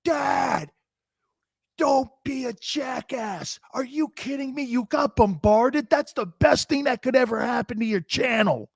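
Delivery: high, mock teen daughter scolding her dad; imitating daughter. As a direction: squeak it up like a scolding teenage daughter. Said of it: "my daughter comes running down going".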